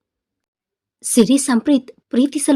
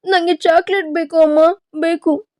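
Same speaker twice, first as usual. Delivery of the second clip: put-on higher, childish whining for chocolate; whiny child tantrum; 6 semitones higher than usual. Girl acting a stubborn child demanding chocolate from mum, whiny and higher. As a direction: play a sulky little kid begging mum. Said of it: "ಅಂತ ಅಳೋಕೆ ಶುರು ಮಾಡಿದಳು".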